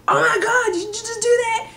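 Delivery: high-pitched, loud disbelief; mock appalled outcry. Imitating classmate who smells it first, appalled high outcry. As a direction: shriek it in exaggerated disgust.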